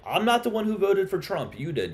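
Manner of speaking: ordinary delivery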